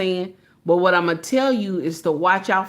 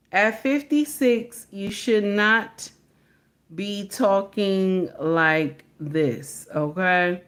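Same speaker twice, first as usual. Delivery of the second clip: drawn-out reprimand, pausing between phrases; slow, deliberate admonishing; slower than usual. Real, not acted. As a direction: stretch the words out to make the scolding sink in. Repeats the scolding slowly for emphasis.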